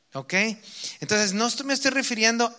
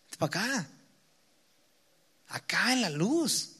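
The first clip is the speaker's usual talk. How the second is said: soft, inviting call, a little quieter; gentle calling; 3 semitones higher, 6 dB quieter than usual. Acted. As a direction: call them gently toward the light. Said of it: "el Señor nos está diciendo". (llamado suave y tierno del Señor)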